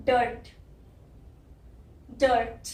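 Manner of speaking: डर्ट said with a short neutral ur sound, dur-t; slow clear demo of डर्ट; pron demo. Shown as a demonstration. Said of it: "यहाँ पे भी आई की यही sound आ रही है". (डर्ट का उच्चारण धीरे से)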